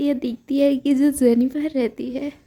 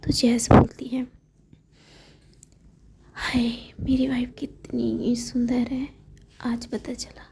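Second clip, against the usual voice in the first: hushed whisper for an intimate scene; whispered storytelling. Acted. Narrator telling the couple's tender moment, whispered. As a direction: whisper every word as if someone might overhear.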